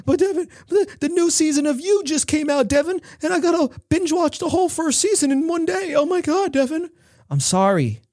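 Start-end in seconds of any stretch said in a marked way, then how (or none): 0.1-6.9 much higher, mock pleading excuse; hammed-up listener begging to binge TV; whiny listener. An imagined listener whining to Devin, much higher and pleading. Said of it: "There's somebody listening to this".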